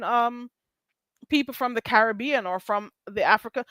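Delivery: ordinary talk, nothing marked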